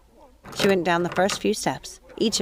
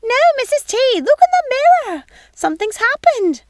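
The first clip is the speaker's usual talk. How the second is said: high, loud flustered maid voice; alarmed young housekeeper pleading; high excited servant's voice; Missus Pickle, urgent; 8 dB louder than usual. Acted.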